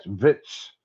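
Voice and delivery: "vits" in place of votes; mocking mispronunciation. Commentator spelling out Trump's word, mocking "vits". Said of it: "Doesn't say votes like everyone else".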